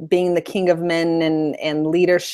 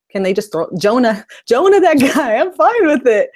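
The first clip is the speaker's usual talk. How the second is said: higher, laughing, tossing the idea out; amused dismissal; 6 semitones higher, 4 dB louder than usual. Retold or imitated. Co-host retells not caring about the crew, amused dismissal breaking into laughter. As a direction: laugh through a mock-impatient reaction, rising higher and louder.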